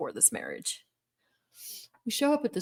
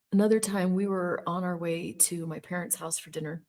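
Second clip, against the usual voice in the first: plain reading voice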